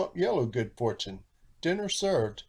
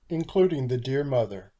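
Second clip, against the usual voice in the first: ordinary delivery, nothing marked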